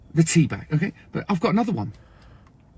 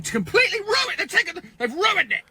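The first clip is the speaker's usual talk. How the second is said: much higher, exasperated; outraged. Real, not acted. Brit outraged at Americans ruining words, high and exasperated. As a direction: cry out in mock outrage.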